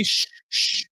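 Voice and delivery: voiceless shush; demo shush. Shown as a demonstration. Demonstrating to viewers why people shush. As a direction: show the shushing sound. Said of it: "why people say".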